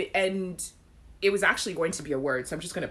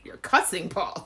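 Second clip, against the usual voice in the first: ordinary talk, nothing marked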